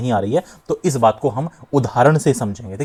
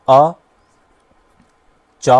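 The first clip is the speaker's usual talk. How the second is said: "अ" and "चा" as lone syllables with a long pause between; isolated syllables; 5 dB louder, much slower than usual. Shown as a demonstration. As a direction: give "अ", wait, then "चा". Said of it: "इस तरह से तोड़ दूं". ("अ" और "चा" को अलग-अलग रुककर बोलें)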